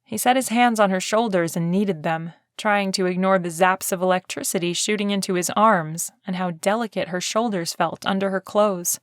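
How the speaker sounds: ordinary delivery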